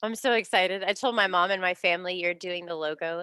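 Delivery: normal talking voice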